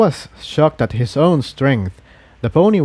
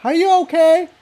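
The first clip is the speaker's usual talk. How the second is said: calling out. Acted. A pony calls to check after the boom, worried. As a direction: call across the building.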